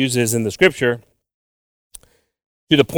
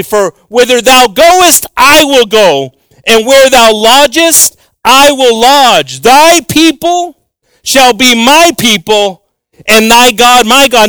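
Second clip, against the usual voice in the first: higher, louder and slow, like reading from the pulpit; solemn scripture reading; 8 semitones higher, 6 dB louder, slower than usual. Acted. Preacher reads Ruth's vow to the congregation, solemnly. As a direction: proclaim the verse with weight.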